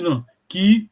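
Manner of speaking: ordinary delivery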